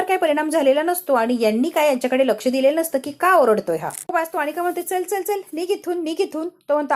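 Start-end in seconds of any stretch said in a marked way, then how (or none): 4.8-6.5 higher, scornful shooing away; angry dismissal. Acted. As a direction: chase him off, raised pitch, impatient. Anika chases away the servant.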